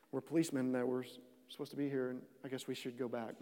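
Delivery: dazed guards mumbling, half laughing; sheepish officers. Retold or imitated. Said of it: "And they're like".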